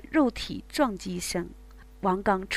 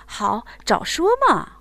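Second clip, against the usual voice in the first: loud, eager agreement; eager man; 4 semitones higher, 7 dB louder than usual. Acted. Man answering his lover, loud and eager. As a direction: jump at the idea. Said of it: "王刚激动地要抽身".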